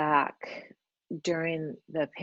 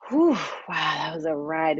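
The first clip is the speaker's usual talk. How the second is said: amazed exclamation; 4 dB louder than usual. Retold or imitated. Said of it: "looking at the last year and saying".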